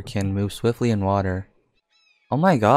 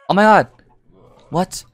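high, loud, startled exclamation; real jolt of alarm, pitched way up; startled outburst; 9 semitones higher, 8 dB louder than usual. Real, not acted. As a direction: burst out in sudden shock. Startled by attack in game.

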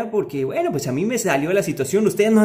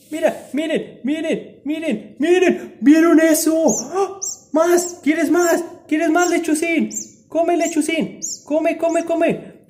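high-pitched, urgent excitement; high, loud feeding-time delight; excited squealing; 8 semitones higher, 5 dB louder, slower than usual. Real, not acted. Excitedly feeding the baby bird.

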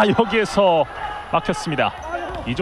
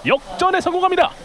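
high shout stressing 역전에; excited goal call; 12 semitones higher, 3 dB louder than usual. Real, not acted. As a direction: announce the comeback at full voice. Goal call, lead taken.

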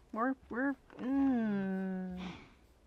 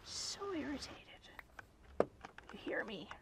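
irritated whisper; 12 dB quieter than usual. Real, not acted. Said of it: "This is the sound of me getting irritated".

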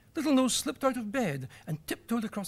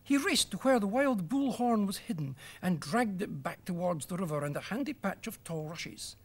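ordinary delivery

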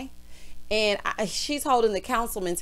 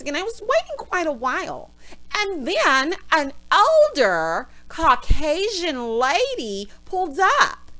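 exaggerated high voice, swelling to loud outrage; mock high-pitched voice; 6 semitones higher, 5 dB louder than usual. [name] telling her gas station story, mock high-pitched complaint. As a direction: imitate an over-pitched, indignant storyteller. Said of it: "bring your voice down just one octave".

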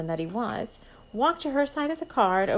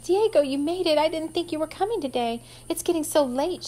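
higher, louder, bubbling over to see him; eager girlish greeting; 7 semitones higher, 3 dB louder than usual. Acted. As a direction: call out to him, thrilled he came. Amber runs out to Diego.

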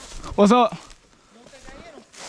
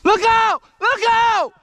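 high shouted call; loud call; 5 dB louder, slower than usual. Real, not acted. Calling out loud in woods.

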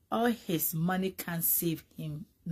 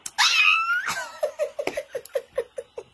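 shrieking burst of laughter, much higher; loud high laugh; 7 dB louder than usual. Real, not acted. Laughing at her own joke about gowns, shrieking.

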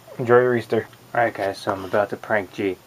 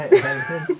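sudden high laugh; high burst of laughter. Real, not acted. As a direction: laugh out, caught in the act. Caught hiding under the mattress.

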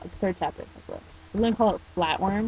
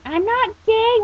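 high, loud outburst; indignant cry; 8 dB louder, slower than usual. Acted. Teen shouts over the girls' chanting, indignant cry. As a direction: cry out in exasperated denial. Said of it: "Watanuki cried".

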